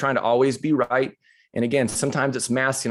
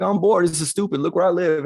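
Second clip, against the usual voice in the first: higher mock whine of boredom; complaining young person; 4 semitones higher than usual. Speaker imitating bored young people griping about life, whiny. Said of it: "most young people be like".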